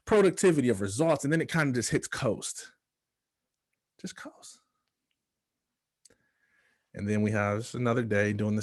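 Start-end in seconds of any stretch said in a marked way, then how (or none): 4.0-4.6 whisper. Acted. Echoing phrase in a whisper.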